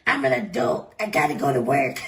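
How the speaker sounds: low, self-important workaholic; put-on low dutiful voice; mock serious adult. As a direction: drop the pitch for a stern grown-up act. Acting out the workaholic querent.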